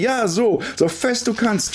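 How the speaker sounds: very high, drawn cry; thrilled scream. A narrator's reading. Girl flying high on the swing, screaming. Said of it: "schreit sie".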